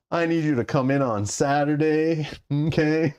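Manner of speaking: a hint of a drawling boss; pretend boss. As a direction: make a mock office-boss request. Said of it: "That's what I picture as Mike. Mike's the boss".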